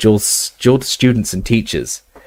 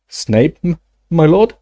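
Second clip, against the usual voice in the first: higher, timid stammering reply; nervous servant; 6 semitones higher than usual. Acted. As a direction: stammer it out, frightened. Frightened servant answers Voldemort.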